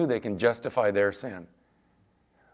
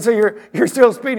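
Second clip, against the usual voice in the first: incredulous; 10 semitones higher, 9 dB louder than usual. Retold or imitated. Pastor retells challenging speeding man.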